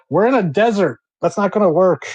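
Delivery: loud, high-pitched protest; acted-out exasperated outburst. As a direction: throw up your hands at a hopeless idea. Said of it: "Just throws up his hands".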